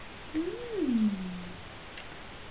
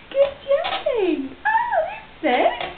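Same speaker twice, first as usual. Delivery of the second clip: much higher, slow, laughing pet talk; squeaky praising a dog; 12 semitones higher, slower than usual. Real, not acted.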